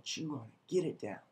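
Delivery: soft, intimate push; quiet urging. Retold or imitated. Preacher voicing Jesus urging the twelve, softly. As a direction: urge them gently under the breath.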